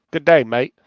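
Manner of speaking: imitating an Australian accent, "Good day, mate.". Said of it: "the closest I can get to an Australian accent".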